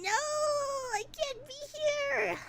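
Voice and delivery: high, drawn-out wailing refusal; mock despair. Real, not acted. Streamer tempted by a Steam sale, wailing in protest.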